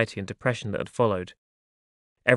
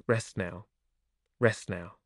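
very soft, lower, tender hush; gentle soothing; 4 semitones lower, 10 dB quieter, slower than usual. A narrator's reading. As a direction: soothe them gently to rest, barely raising the voice. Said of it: "stroked my body gently and said".

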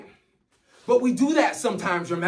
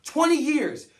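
ordinary delivery

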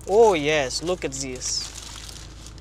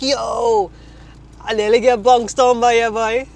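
put-on Caribbean accent; 6 semitones higher, 7 dB louder, slower than usual. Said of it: "I was just like".

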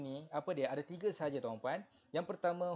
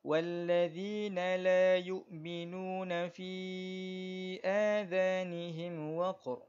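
slow, higher melodic tajwid chant; Quran recitation; 4 semitones higher, 5 dB louder than usual. Shown as a demonstration. Teacher reciting verse for the class, slow melodic tajwid. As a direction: recite for students to follow.

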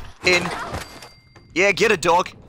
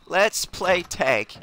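Let's play tag said very slowly; slow drawn-out taunt; much slower than usual. Shown as a demonstration. Taunting an enemy in the game, slow drawn-out tease. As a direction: stretch it out teasingly.

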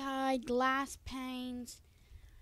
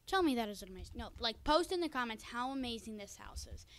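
ordinary talk

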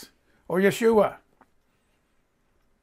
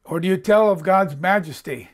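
normal talking voice

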